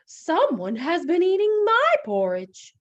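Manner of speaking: high, loud mama bear; Mama Bear's middle-sized voice. Acted. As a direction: pitch it up as an upset mother bear. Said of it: "she said in her middle-sized voice".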